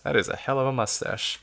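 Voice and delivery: ordinary delivery, nothing marked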